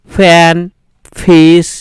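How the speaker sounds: "ফ্যান" and "ফিস" opened with a light English f; loud teaching demo of "ফ্যান, ফিস" with half ফ. Shown as a demonstration. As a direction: demonstrate f, not ph, in "ফ্যান, ফিস". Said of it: "অর্ধেক ফ এর উচ্চারণ হচ্ছে".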